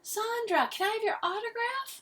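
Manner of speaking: very high fan squeal; squeaky, excited call. A narrator's reading. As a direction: plead in a starstruck high voice. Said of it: "Missus Martinez called out".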